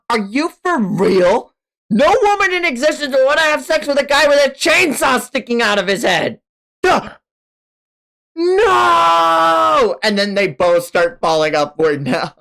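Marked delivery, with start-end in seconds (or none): 0.1-7.1 high mocking girl voice, louder; scoffing Asa. 8.3-10.0 high shouted no; high loud shout of denial; anguished no. Acted.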